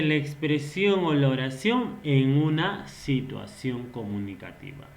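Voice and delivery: ordinary talk, nothing marked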